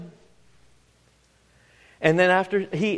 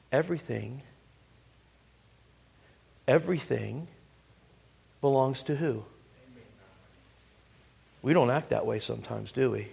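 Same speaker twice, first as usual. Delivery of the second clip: hushed appeal; 5 semitones lower, 8 dB quieter than usual. Real, not acted. Preacher drops to hushed appeal.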